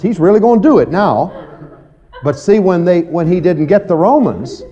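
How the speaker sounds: ordinary delivery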